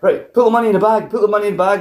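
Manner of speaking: mock robber's demand, high and quick; urgent robber. Robber demanding money, retold.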